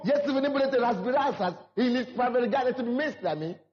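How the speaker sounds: ordinary talk, nothing marked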